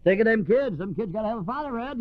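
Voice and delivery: mock drunk's sugary promises; old schemer. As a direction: sweet-talk her like a practiced con. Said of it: "the old schemer".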